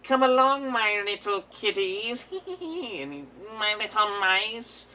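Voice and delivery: high, slow, creepy coaxing; sing-song creepy child catcher. Retold or imitated. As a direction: lure the kids like a creepy villain. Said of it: "that creepy guy who's like".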